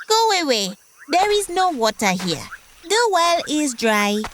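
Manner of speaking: a proud little frog shooing him off. Acted.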